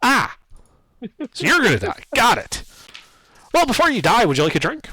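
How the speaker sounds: high, loud, cheerful gnome voice; cheery gnome. Acted. Old gnome offering rum to a doomed guard, cheerfully loud. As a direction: sell it brightly like a gnome merchant.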